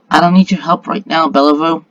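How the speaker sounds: usual speaking voice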